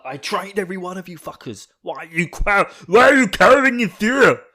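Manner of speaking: builds to a high, loud drill-sergeant bellow; gruff commander. Retold or imitated. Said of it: "He's just like".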